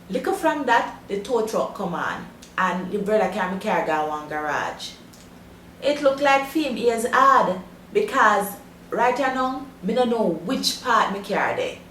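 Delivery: broad Jamaican patois storytelling. Shown as a demonstration. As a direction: tell it in straight Jamaican patois. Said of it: "listen to it again".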